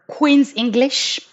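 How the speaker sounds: Queen's English attempt from a French speaker. Shown as a demonstration. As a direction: stretch it out in your best Queen's English. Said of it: "with my very best".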